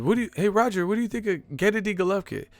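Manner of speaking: high, quick interviewer impression; Ellie Seckbach. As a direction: imitate a reporter firing off a question. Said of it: "I remember his voice".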